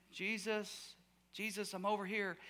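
small, soft timid call; meek, casual call. Shown as a demonstration. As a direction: barely try to get his attention. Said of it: "not just kinda saying".

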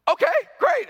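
high, loud mock cheer; hammed-up enthusiasm. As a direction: imitate an abrupt, over-bright sign-off. Acting out the abrupt fist-bump ending.

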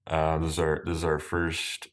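usual speaking voice

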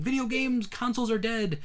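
high, dramatic proclamation; doom-saying headline. Retold or imitated. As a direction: announce it like the end of the world.

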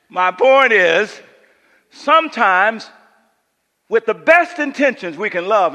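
loud, high, driving pulpit voice; full-force preaching. Acted. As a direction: preach it hard to the whole room.

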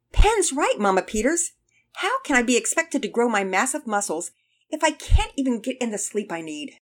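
put-on loud, high-pitched boyish complaint; protesting son. A narrator's reading. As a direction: argue loudly with Mom, starting high and indignant. Son joins the protest to mother.

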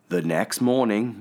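plain reading voice

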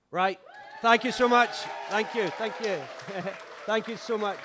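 high, loud, beaming thank-yous with a laugh; gushing loud gratitude to the kitchen team. Real, not acted. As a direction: cheer them as they come out.

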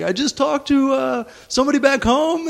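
high mock-distraught voice; losing it tearfully. Homesick kid mentioning a call home, mock-distraught. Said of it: "losing his shit".

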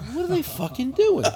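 high, giggling bafflement; laughing disbelief. Retold or imitated. Watching men with shopping carts, laughing high. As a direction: laugh at the absurd sight. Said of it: "I'm like".

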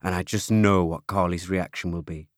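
usual speaking voice

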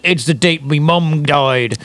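slow, mock British accent for the villain; lightly hammed-up British accent; put-on British accent. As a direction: say it like a British thug, slowed down. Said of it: "or whatever his accent is".

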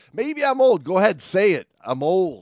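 loud, high mock protest; mock outburst. Host daring co-host to say it, loud mock indignation. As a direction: protest loudly in jest, pitched well up.

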